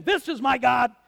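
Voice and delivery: loud certain proclamation; Elijah proclaiming. Acted. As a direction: announce it like he's sure. Elijah mistakes noise for God.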